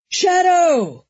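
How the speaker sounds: high, loud holler. A narrator's reading. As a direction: call the dog across the chaos. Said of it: "he hollered".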